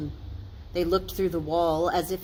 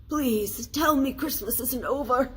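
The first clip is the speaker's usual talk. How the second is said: pleading, pitched higher; a higher, urgent plea; begging; 7 semitones higher than usual. A narrator's reading. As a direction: beg the spirit earnestly. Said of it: "he begged the spirit".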